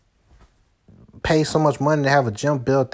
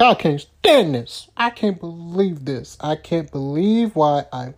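upset whining; 6 semitones higher, slower than usual. Retold or imitated. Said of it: "I just get so emotional".

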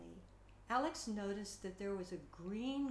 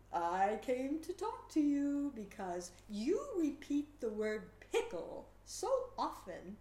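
pickle character; 5 semitones higher, 7 dB louder than usual. Acted.

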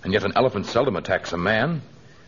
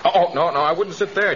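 urgent warning; 7 semitones higher than usual. Acted. Tarzan stops Torgo sitting on tortoise.

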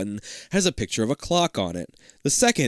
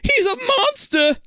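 high, loud scream of fright; terrified yell; 8 dB louder, slower than usual. Acted. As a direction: shriek at the monster. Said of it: "Liz yells".

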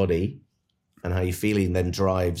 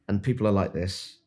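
normal talking voice

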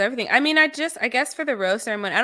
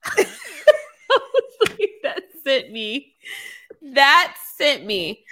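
drawn out slowly, much higher, breaking into laughs; laughing; 5 semitones higher, 5 dB louder, much slower than usual. Real, not acted. Recalling Madison's joke, slow laughing delivery. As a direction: stretch the words while cracking up.

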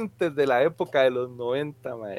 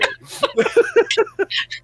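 long laugh. Real, not acted. Long laugh after joke.

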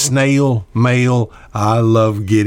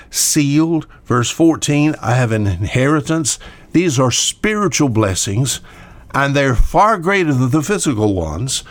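normal talking voice

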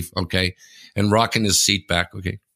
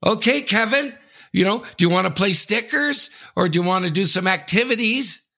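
high sing-song mom voice, much higher; overbearing mother; 8 semitones higher than usual. Retold or imitated. Mocking the overbearing mother on the plane, high sing-song. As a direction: parody a loud doting mother. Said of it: "the overbearing mother who's talking loud".

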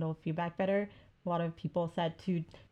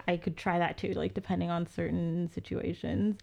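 normal talking voice

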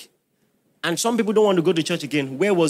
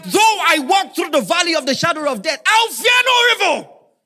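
much higher and louder preached shout; full-force preacher shout of scripture; shouted psalm; 9 semitones higher, 6 dB louder than usual. Real, not acted. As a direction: cry the verse out with full force. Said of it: "psalm shouted again".

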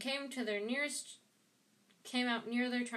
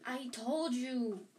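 slow, drawn-out gasp; mildly breathless insistence; 3 semitones higher, 3 dB louder, much slower than usual. A narrator's reading. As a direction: stretch it out as if winded. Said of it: "Hermione gasped".

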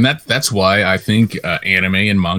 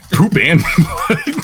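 laughing; 8 semitones higher, much slower than usual. Real, not acted. Laughing repeat of gross line.

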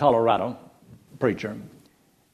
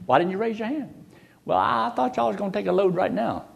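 comic dialogue; 9 semitones higher than usual. Retold or imitated. Joke dialogue, high voices.

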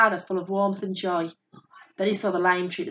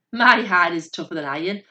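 usual speaking voice

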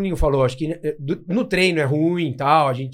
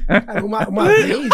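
much higher, laughing; 5 semitones higher, 4 dB louder than usual. Real, not acted. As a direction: try to talk through laughter. Laughing trying to start story. (rindo sem conseguir falar)

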